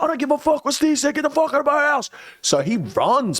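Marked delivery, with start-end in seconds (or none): 0.0-2.1 high-pitched, fast mock yell; exaggerated high voice ordering him out; retold yelling. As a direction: rattle it off in a squeaky rant.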